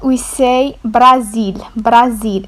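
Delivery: Brazil with a long open a, stress on zil; Moroccan pronunciation. Shown as a demonstration. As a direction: say Brazil as brah-ZEEL, clearly. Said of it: "we just changed the pronunciation, the tone".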